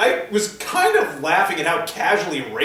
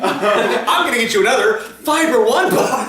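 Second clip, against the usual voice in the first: laughing tease; 7 semitones higher, 5 dB louder than usual. Real, not acted. Teasing hungry friend.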